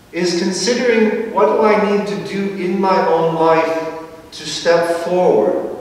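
ordinary delivery

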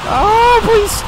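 very high, loud panicked plea; desperate scream. Real, not acted. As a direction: shriek it out, begging for mercy. Desperate in losing game fight.

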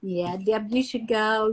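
ordinary delivery

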